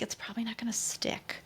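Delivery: whispered aside. Retold or imitated. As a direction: breathe it out under your breath. Inner thought, whispered aside.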